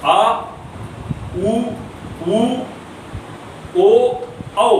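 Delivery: isolated back vowels बड़ा आ, उ, ओ, औ with pauses between; vowel demo. Shown as a demonstration. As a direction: say आ उ ओ औ slowly one after another. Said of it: "ये चारों, पांचों".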